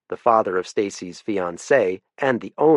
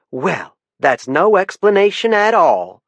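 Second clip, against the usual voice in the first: much higher, louder indignant lady; indignant Southern wife; 10 semitones higher, 6 dB louder, slower than usual. Acted. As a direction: sound affronted like a proper Southern wife. Wife rebukes the warden.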